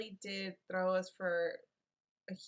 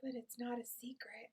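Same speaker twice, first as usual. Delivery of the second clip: whispering; 4 semitones higher, 7 dB quieter than usual. Pretend. Teasing reply in whisper.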